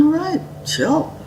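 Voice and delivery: high, dismissive all right chill; exasperated. Retold or imitated. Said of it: "So I'm going".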